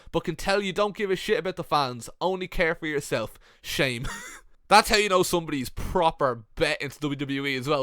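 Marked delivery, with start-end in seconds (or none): none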